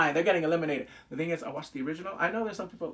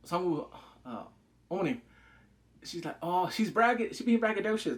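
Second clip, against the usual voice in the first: ordinary talk, nothing marked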